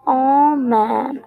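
plain reading voice

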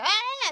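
short high mock scream of fright; quick high retold shriek. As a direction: yelp it high like a startled girl. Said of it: "Sarah is like".

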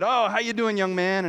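a hint of a jovial older gentleman; cheery older man. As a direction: boom out a friendly hello like an old gent. Older man greets him warmly.

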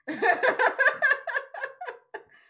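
loud cackle, high; loud high laugh. Real, not acted. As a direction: burst out laughing. Laughs at own joke.

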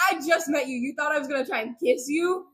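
playfully scandalized questioning; mock indignation. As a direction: imitate an affronted young prince. Voicing the prince's indignant protest.

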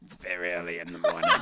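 put-on fast laughing New York accent. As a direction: rattle it off like a Brooklynite. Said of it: "almost like a New Yorker accent".

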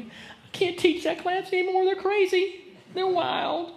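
high, mock-distressed whine; whining wife. Wife whines about her wild class, high mock whine. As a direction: complain like an overwhelmed teacher. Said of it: "or your wife ever cried to you".

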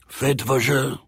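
French croupier calling out bets; casino croupier. Acted. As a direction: sing out the call to place bets. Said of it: "called the croupier".

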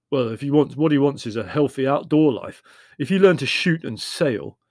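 a hint of a stubborn brother pushing his idea; lightly voiced Leslie, insistent.

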